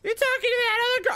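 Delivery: put-on high, fast, accusing girlfriend; shrill-free high fast jealous nagging. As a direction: squeal it like a jealous partner. Jealous partner voiced.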